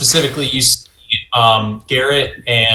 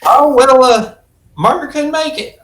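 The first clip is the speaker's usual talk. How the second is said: high put-on voice for Anne; a squeaky stab at Anne. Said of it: "I don't know why I'm doing my Anne voice".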